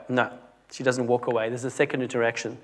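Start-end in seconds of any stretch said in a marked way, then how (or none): none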